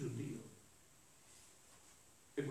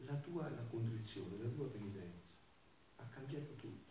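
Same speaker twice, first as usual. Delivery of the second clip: much quieter quoted voice; soft retold reply; 10 dB quieter than usual. Preacher voices Jesus answering the penitent Gemma, very softly. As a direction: let the reply sink almost to nothing.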